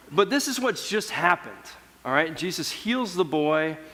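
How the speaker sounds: ordinary talk, nothing marked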